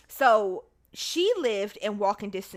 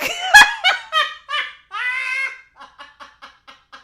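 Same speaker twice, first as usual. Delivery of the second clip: loud, high howl of laughter; real laughter; 11 dB louder than usual. Real, not acted. Storyteller losing it at her confession, loud laughter. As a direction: explode laughing, then wheeze out.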